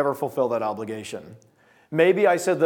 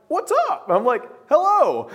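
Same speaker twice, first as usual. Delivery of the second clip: hammed-up greeting; 12 semitones higher, 4 dB louder than usual. Opening the door late at night.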